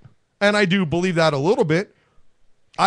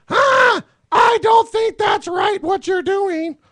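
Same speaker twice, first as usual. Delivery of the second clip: high, slow mock scolding voice; mock Hillary Clinton; 12 semitones higher, 5 dB louder, slower than usual. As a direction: lift it way up into a prim, prissy protest. Mocking Hillary Clinton's weak protest.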